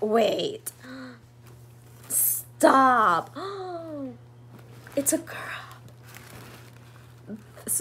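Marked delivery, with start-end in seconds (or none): 3.4-4.1 quiet, high delighted gasp; real gasp of delight; soft gasp. Real, not acted. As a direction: gasp at the surprise. Discovering the gift is a girl.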